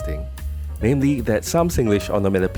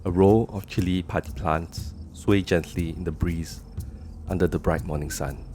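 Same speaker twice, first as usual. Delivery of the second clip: screenplay narration; 5 dB quieter than usual. A narrator's reading. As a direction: read it like a movie opening. Screenplay scene setting.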